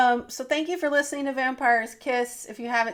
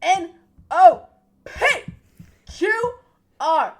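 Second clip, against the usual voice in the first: peppy cheerleader chant; 7 semitones higher, 9 dB louder than usual. Acted. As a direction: cheer the alphabet like a pep rally. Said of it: "God, I sound like a cheerleader".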